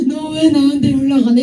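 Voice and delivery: jealous demand. Retold or imitated. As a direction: demand it through the glass, loud and hurt. Jealous boyfriend at window.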